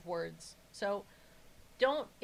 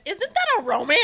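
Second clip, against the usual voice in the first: imitating mom; 9 semitones higher, 15 dB louder than usual. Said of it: "she went".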